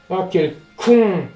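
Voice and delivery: drawn-out, loud self-scolding; slow frustrated groan. Real, not acted. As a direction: groan at your own mistake. Self-insult after missing.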